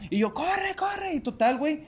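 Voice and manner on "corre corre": high urgent yell; retold shout. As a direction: yell down at mom to run. Shouting at mom to run.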